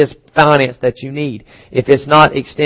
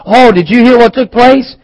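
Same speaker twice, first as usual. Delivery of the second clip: higher, louder breathless gossip; excited gossip; 8 semitones higher, 5 dB louder than usual. Retold or imitated. To the congregation, imitating gossiping churchgoers, eager and raised. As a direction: pass on the news eagerly, voice up.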